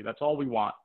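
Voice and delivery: normal talking voice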